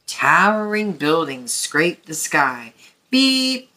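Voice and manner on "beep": higher, playful beep; Blue's friendly horn beep; bright horn beep. A narrator's reading. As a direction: beep like a little truck horn. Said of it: "said Blue".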